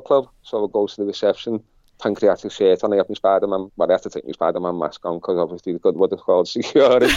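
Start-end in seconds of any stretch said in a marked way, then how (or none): none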